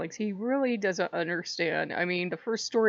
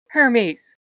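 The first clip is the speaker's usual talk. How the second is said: stern mother; 3 semitones higher, 9 dB louder than usual. Retold or imitated. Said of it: "his mother's like".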